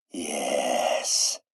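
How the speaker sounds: low, drawn-out sinister yes; dark satisfaction. Acted. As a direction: savour it, low in the throat. Villain savours his plot.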